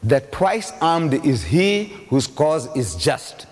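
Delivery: proverb spoken slowly; slow quotation. Shown as a demonstration. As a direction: lay out the saying word by word. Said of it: "As the saying goes".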